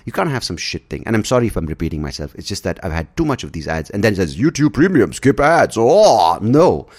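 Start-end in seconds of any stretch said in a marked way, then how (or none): 4.4-6.9 mock announcement. Mocking YouTube's pitch.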